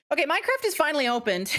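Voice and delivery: breathless and excited; rushed words, short of air; out of breath. Real, not acted. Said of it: "I am so out of breath".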